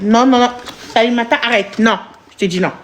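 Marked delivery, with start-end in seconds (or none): none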